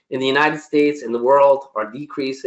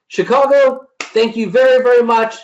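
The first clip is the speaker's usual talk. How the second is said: high, loud, enthusiastic sign-off; rousing thanks; 9 semitones higher, 5 dB louder than usual. Real, not acted. Speaker closing a talk to Chicago, rousing thanks. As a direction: thank the whole hall with raised energy.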